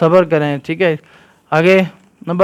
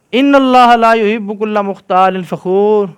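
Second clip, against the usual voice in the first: Quran recitation; 4 semitones higher than usual. Acted. Teacher reciting the verse to the children in Quran style.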